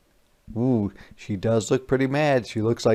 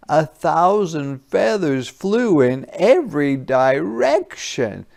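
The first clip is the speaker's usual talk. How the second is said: louder, slower wonder; dramatic narration; 5 semitones higher, 5 dB louder than usual. A narrator's reading. Reading the big mess to a child, dramatic and louder. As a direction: make the feathers burst out.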